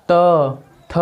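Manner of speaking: त and थ said alone, tongue tapping the teeth; teacher showing त थ; dental demo. Shown as a demonstration. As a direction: sound out त थ lightly, one at a time. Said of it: "दांतों को स्पर्श कर रही है".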